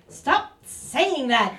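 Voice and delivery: slow exasperated demand; mock-annoyed demand. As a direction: drag it out, fed up. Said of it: "demanded Count Rugen".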